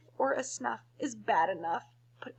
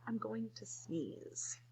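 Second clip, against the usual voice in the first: whisper; 12 dB quieter, much slower than usual. Acted. Elephant warns before sneezing.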